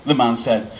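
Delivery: ordinary delivery, nothing marked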